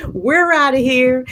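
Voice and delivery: loud, high storybook yelp; acted getaway shout; panicked exit cry. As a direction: call out as you bolt from danger. Mice flee from the cat.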